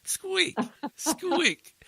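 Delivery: very high mimicked squeaks; short high squeaky sound effect; imitated squeak. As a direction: squeal like a creeping truck. Imitates truck squeak in story.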